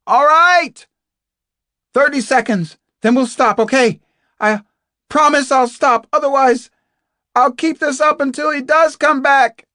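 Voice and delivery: high, insistent yell; shouted bargaining. Acted. Captive bargaining with a silent fellow prisoner, shouting. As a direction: press the deal at full volume.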